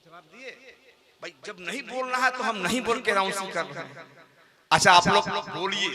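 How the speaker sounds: normal talking voice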